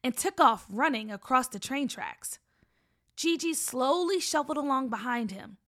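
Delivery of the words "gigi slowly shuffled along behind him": ordinary delivery, nothing marked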